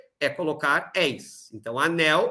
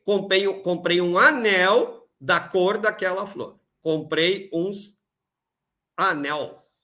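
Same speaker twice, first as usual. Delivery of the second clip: slow reading aloud; slower than usual. Shown as a demonstration. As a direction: read the sentence slowly with a pause before "anels". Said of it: "E letra E". (leitura lenta com pausa antes de "anels")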